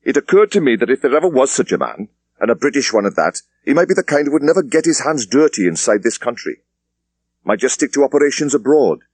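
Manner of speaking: a superintendent dismissing his junior; police superintendent. Acted. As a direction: wrap it up with brisk authority. Thomas explains.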